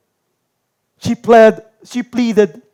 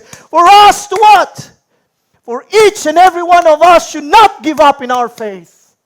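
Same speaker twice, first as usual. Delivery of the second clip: higher, louder, driving exhortation; fiery preaching; 7 semitones higher, 7 dB louder than usual. Acted. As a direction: preach it at full force to the congregation. Preaching climax, urging trust. (masigasig na pangangaral nang malakas)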